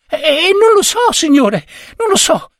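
put-on very high, stuttering frightened boy; stammering boy. Boy stammering to his superior that he doesn't know. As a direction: squeak it out, scared of the boss. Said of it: "disse il ragazzo".

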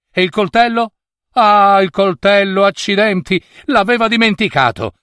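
high-pitched dismayed realisation; sudden alarm. A narrator's reading. Narrator voices the king finding the knife forgotten, alarmed. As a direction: exclaim high over a blunder just noticed.

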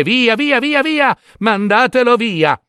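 high-pitched string of impatient dismissals; frantic shooing. Acted. King's daughter gets rid of the second suitor, impatient.

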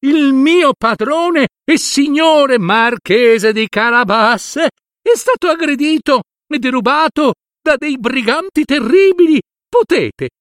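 cat begging the king to save his master; cry for help. Acted. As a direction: shout for help to the king, frantic. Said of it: "a sbracciarsi e a chiedere".

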